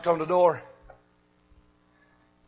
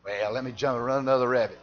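ordinary delivery

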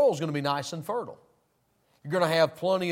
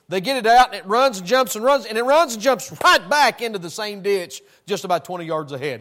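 loud, rising preacher energy building on each run; excited retelling; 3 semitones higher, 6 dB louder than usual. Real, not acted. Preacher telling the flock about the sheep video, excited and loud. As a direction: tell it like it's the funniest thing you've seen. Describing sheep jumping back into ditch.

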